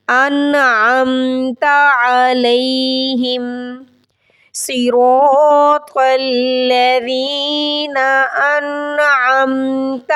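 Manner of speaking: slow chant, pressing the ya of وإياك; recite, leaning on the shadda; stressed recitation. Shown as a demonstration. Teacher reciting with the stressed ya.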